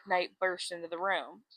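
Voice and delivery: ordinary talk, nothing marked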